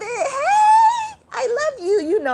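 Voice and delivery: very high, loud laughing hello; excited greeting. Real, not acted. Greeting Moshe in the live chat with a very high, laughing squeal.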